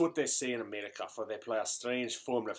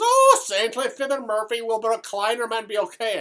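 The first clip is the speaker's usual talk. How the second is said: mock pleading; 8 semitones higher, 6 dB louder than usual. Pleading with the priest.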